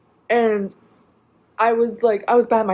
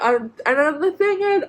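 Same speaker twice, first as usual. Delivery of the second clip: ordinary talk, nothing marked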